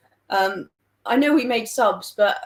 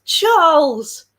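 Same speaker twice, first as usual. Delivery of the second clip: loud, high, drawn-out name call; big, high, falling shout of recognition; 7 semitones higher, 10 dB louder than usual. Real, not acted. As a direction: exclaim the name as a friend turns up. Calls out a friend joining chat.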